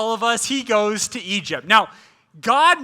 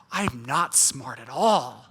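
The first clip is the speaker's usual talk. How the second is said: rueful self-mockery; 9 semitones lower, 5 dB quieter, slower than usual. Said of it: "looking back at your plan and going".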